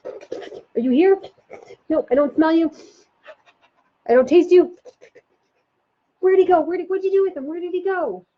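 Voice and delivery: high puppy voice, sniffing about; little puppy. Shown as a demonstration. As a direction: play a puppy searching for the child. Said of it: "maybe you're a little puppy".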